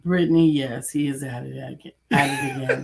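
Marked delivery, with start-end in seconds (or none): 2.1-2.8 squeal. Acted. Mock exasperation.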